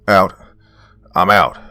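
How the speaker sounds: loud soldier's callout; short, forceful yell to the team; yelled alert. A narrator's reading. Seal out of ammo alerting the team, yelled. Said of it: "yelled one of my seals".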